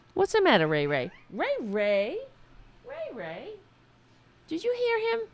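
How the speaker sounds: high, soft sing-song talk to a pet cat. Real, not acted. Talking to his cat Ray Ray, soft high cooing baby talk.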